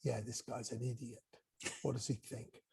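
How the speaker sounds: soft scornful mutter among students; mocking mutter. Students mocking teacher under breath, soft scorn. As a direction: mutter it dismissively to a classmate. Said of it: "some of the class are like".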